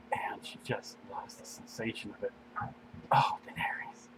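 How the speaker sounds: unvoiced whisper, barely audible; breathy whisper. Reading a romance scene to the listener, whispering. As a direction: breathe the words out in a hush. Intimate love scene read aloud.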